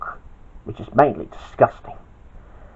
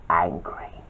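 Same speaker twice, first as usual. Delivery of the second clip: breathy whispered word; 9 semitones lower, 10 dB quieter than usual. A narrator's reading. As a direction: drop to a whisper for effect.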